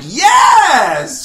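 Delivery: loud, high, joyful shout; cheering yes. Retold or imitated. Husband cheers his wife's caption with a loud, happy shout. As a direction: cheer it like a fan.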